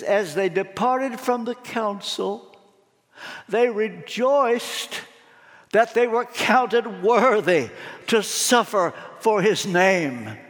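very slow, weighty preacher's delivery; emphatic scripture reading. A narrator's reading. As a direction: dwell on each phrase of the verse, drawn out.

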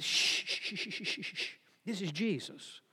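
acted hush from an onlooker; crowd hushing. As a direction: shush someone urgently under your breath. Crowd hushing the man crying out.

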